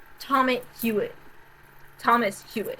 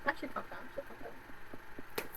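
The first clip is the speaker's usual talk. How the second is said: calming down; 3 semitones higher, 17 dB quieter, much faster than usual. Real, not acted. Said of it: "I'm so pissed".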